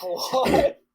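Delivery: high, loud startled what; seeing the second porn, blurting what in disbelief; incredulous. Retold or imitated. Another porn fired up.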